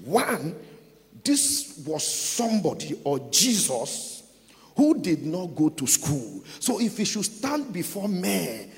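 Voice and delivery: loud, emphatic sermon delivery; preaching at full force. Real, not acted. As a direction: proclaim it forcefully to a congregation.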